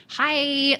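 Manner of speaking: "hi" high, loud and drawn out; joyful hi. Shown as a demonstration. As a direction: greet with a big, delighted, stretched "hi". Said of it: "joyfully say hello to somebody".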